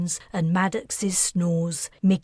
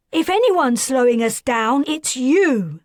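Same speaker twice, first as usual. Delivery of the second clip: higher, louder contemptuous retort; spat out; 6 semitones higher, 7 dB louder than usual. A narrator's reading. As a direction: throw the insult back at him. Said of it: "Wilder spat".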